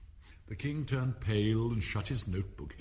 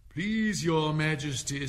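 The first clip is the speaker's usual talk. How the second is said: slow, raised appeal to royalty; pleading Knave; 6 semitones higher, 5 dB louder, slower than usual. A narrator's reading. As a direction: plead slowly, higher and louder. Knave pleads before the King.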